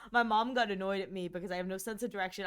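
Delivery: ordinary delivery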